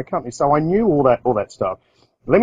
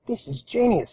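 high, hushed "genius"; softer and pitched up, a mock eureka; delighted thought; 10 semitones higher, 7 dB quieter than usual. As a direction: savour the idea quietly but thrilled. His delighted inner thought.

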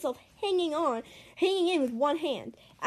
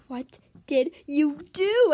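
slow, louder mock scream, each word punched; half-hearted scream of accusation; 2 semitones higher, 6 dB louder, slower than usual. A narrator's reading. Girl to Jordan after the screen cracks, mock scream. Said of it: "I screamed at Jordan".

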